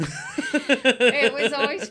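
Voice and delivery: slow words pushed out through laughter; laughing. Real, not acted. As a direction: laugh as you remember it. Laughing at the memory.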